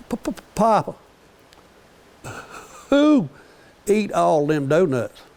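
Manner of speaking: put-on high stammering little boy; child's voice stammering on the first sound; stuttering grandson. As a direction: stutter out the question like a small child. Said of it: "he kinda stutters a little bit".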